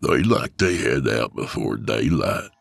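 put-on gruff old man. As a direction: growl it like a creepy old backwoodsman. Old man voiced in story.

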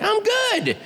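high-pitched, chirpy answer; mock cheery phone voice; eager reply. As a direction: squeak out an over-keen reply. Acted eager reply to caller.

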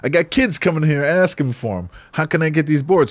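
put-on pestering shopkeeper; pushy shop owner. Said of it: "He's just like".

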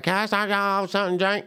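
put-on high, slurred Memphis waitress accent; thick Memphis accent. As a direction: run the words together in a thick Memphis accent. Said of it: "she had a very thick accent".

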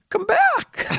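high cracking cry, very high; Rose from Titanic. Retold or imitated. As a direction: wail it across freezing water. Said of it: "This is Rose at the end of Titanic".

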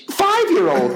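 ordinary talk, nothing marked

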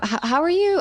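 normal talking voice

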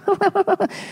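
high, put-on giggle; hammed-up blissful mommy laughter; fake commercial-mom laugh.

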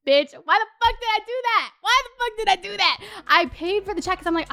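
ordinary talk

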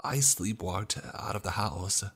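guilty, urgent pleading; pleading apology. Acted. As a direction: beg them to believe you. Guilty man pleads with his family.

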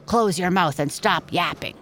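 stern librarian hissing for quiet; hissed scolding. A narrator's reading. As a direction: hiss it as a cross reprimand. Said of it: "He hissed at Pixie to be quiet".

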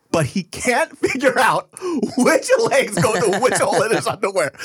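retelling his reply, breaking up laughing. Real, not acted. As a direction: laugh out the punchline.

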